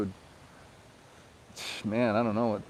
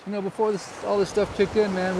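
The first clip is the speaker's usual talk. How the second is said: ordinary talk, nothing marked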